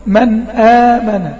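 slow drawn-out recitation of "من آمن"; Quran recitation demo. Shown as a demonstration. Teacher reciting "من آمن" for students, slow and drawn out. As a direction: recite "من آمن" slowly, holding the nun briefly. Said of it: "لما أقول".

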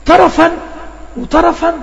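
teacher pronouncing طرفًا; emphatic teaching word. Shown as a demonstration. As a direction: announce طرفًا clearly to the class. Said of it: "يعني بتيجي في الآخر".

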